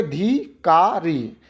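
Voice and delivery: धी, का, री as three separate beats; syllable by syllable. Shown as a demonstration. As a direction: pause after धी and after का. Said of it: "ए पे रुके".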